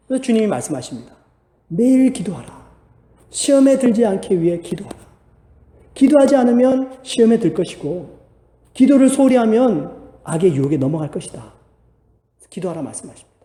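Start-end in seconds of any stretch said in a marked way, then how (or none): 1.7-11.5 higher, firm proclamation of the command. Acted. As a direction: declare it like the Lord's order. Voicing Jesus' command to pray.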